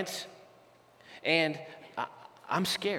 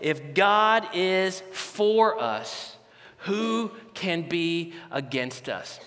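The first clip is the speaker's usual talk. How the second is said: slow reverent reading; much slower than usual. Shown as a demonstration. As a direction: let "If God is for us" settle slowly and gently. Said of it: "where it says".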